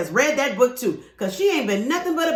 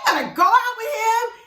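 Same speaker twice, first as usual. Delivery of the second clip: shocked question; 11 semitones higher than usual. Retold or imitated. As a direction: act stunned that she'd date him. Friend's shocked question, retold.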